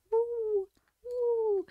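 drawn-out high-pitched "woo"; high playful woo. Real, not acted. Streamer delighting in a rolling raccoon, high playful woo.

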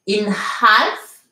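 "half" with a clearly sounded H; H bien prononcé. Shown as a demonstration. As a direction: breathe out the H at the start of "half". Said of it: "avec le H bien prononcé". (« half » avec le H bien prononcé)

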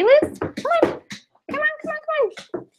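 high, soft sing-song come-on; coaxing Lucy to give her paw, high cooing; coaxing the dog. Real, not acted.

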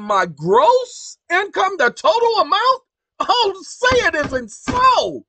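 loud, high, theatrical astonishment; mock disbelief. Host reacting to the tax definition, incredulous. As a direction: play up the shock, stretching the key words.

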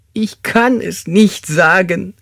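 high, drawn-out, forceful wail; anguished sobbing. Acted. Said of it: "schluchzte er".

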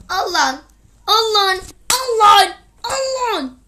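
building from a growl to a furious yell; angry outburst. Real, not acted. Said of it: "biraz sinirlenmiş olabilirim". (giderek artan öfkeli bağırış)

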